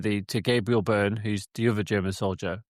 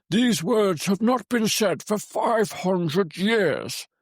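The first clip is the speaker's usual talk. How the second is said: Magneto impression; 9 semitones higher, much slower than usual. Podcaster acting out the film's translation of an ancient etching, hammy impression. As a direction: draw it out in a hammy, raised old-man voice. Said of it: "That's my impression".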